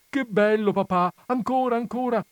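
put-on high, loud delighted kid; excited child. A narrator's reading. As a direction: squeal with joy like a little boy.

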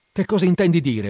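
high, loud alarmed cry. A narrator's reading. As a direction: call out in shock from the bed.

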